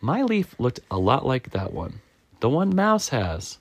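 high, complaining squirrel voice; aggrieved Squirrel. Acted. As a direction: insist in a high, wronged voice.

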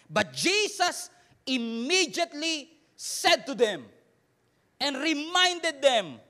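slow, loud, drawn-out verse reading; weighty Bible reading; dramatic scripture reading. Acted. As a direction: build it up slowly for the reveal.

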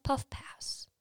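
breathy whispered puff pass. Shown as a demonstration. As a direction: whisper it like a secret tip. Demonstrating gentle puffing rhythm.

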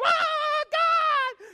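mock excited shout of the crowd; loud shout. Church crowd seeing a healing, shouting out. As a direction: call out high and loud.

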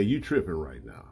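laid-back homie. Retold or imitated. Homies scoffing.

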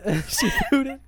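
cracking up, drawn out and high; laughing. Real, not acted. Co-host laughs at a poop joke, cracking up. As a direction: lose it mid-word. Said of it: "We just lost [name]".

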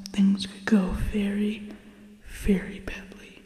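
hushed, secretive villain whisper; conspiratorial whisper. Acted. Dramatic secret plot intro.

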